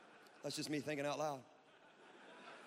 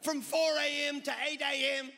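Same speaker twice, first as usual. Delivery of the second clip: loud, high Australian preacher impression; Christine Caine; 8 semitones higher, 7 dB louder than usual. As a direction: proclaim it like a piratical Australian preacher. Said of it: "she sounds like a pirate".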